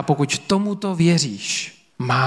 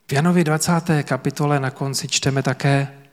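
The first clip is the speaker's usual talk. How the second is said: usual speaking voice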